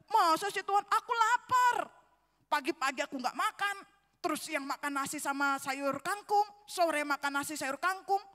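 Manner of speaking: loud, high protest, easing as it goes; retold protest. Preacher haggles with God over being hungry, protesting. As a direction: argue back at God, bursting out then listing grievances. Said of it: "saya mulai nego dengan Tuhan".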